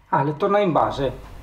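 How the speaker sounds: normal talking voice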